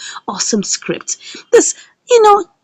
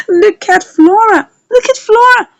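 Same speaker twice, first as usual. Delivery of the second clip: amused exclaim; 13 semitones higher, 5 dB louder than usual. Real, not acted. Narrator cheering Flora on to viewers, excited. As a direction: gush over her like a fan.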